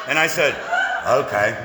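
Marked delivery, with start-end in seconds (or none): 1.1-1.5 Forrest Gump. Acted. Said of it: "I turned into Forrest Gump".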